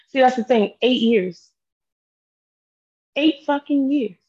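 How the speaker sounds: snapping with frustration; angry. Real, not acted. Angry outburst at ex.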